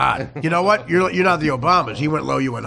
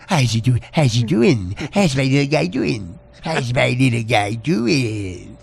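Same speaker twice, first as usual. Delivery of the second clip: put-on low, cooing baby talk to a dog; gentle dog-talk; 5 semitones lower, slower than usual. Host imitating Dan greeting the dog, low doting coo. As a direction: coo it slowly, stretching the last words. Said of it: "and then here's Dan".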